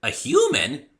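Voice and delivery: very high, loud disbelieving question; surprised. Acted. Rainbow Dash hears about humans, loud and surprised. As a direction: blurt it out in disbelief.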